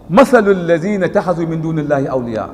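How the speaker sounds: quick, chanted Arabic recitation of the verse; Quran recitation. Real, not acted. To the congregation, recite the Arabic verse in a chant.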